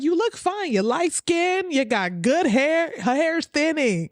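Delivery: high, slow mocking imitation of the host. Reaction streamer mimicking the podcast host, high and slow. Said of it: "He's like".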